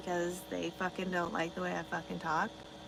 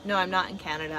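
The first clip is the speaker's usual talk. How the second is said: normal talking voice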